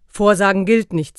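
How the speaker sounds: loud, gruff rebuke. A narrator's reading. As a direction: bark the rule at her. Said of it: "sagte der Weihnachtsmann barsch".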